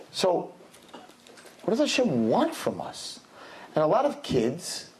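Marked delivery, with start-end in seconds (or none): none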